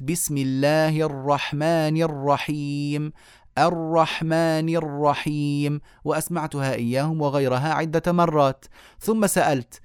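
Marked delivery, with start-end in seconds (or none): 0.0-5.8 slow, drawn-out recitation with the r sounded in "الرحمن الرحيم"; demonstrative recitation. Shown as a demonstration. Teacher testing colleagues on the r, slow measured recitation of "الرحمن الرحيم". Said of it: "فجربت ونطقت أمامهم فقلت".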